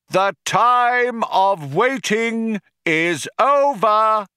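high, drawn-out proclamation; addressing the crowd. A narrator's reading. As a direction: stretch out the grand phrases for a huge crowd. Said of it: "addressing the crowd over a tannoy".

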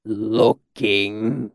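slow, dazed repetition; drawn-out, befuddled murmur; vague echo. A narrator's reading. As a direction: echo the word absently, stretching it out. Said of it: "the mercenary echoed vaguely".

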